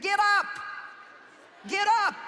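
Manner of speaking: loud, high-pitched order; commanding shout. Acted. Jesus commanding the crippled man.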